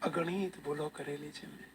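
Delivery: hushed, understated penitent confession; tearful plea. Retold or imitated. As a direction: plead softly for forgiveness, as if through tears. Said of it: "ચોધાર આંસુએ રડતા રડતા".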